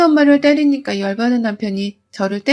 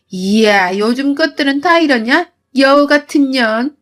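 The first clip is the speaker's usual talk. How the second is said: higher, mocking mother-in-law; sarcastic mother-in-law; 3 semitones higher than usual. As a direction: taunt her with exaggerated fear. (며느리를 비꼬며 높고 과장되게 조롱하는 시어머니)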